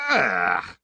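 drawn-out groan of revulsion; disgusted "ugh". A narrator's reading. Door opened on a squalid den.

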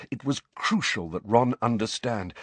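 ordinary delivery

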